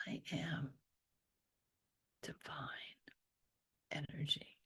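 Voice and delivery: very soft whisper with a smile; smiling whisper. Shown as a demonstration. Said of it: "there's a smile that goes with that".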